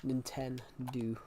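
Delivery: "Nintendo" slow and soft, ending in oo; drawn-out nin-TEN-doo for Nintendo; dad's mispronunciation. Retold or imitated. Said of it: "Or as my dad calls it".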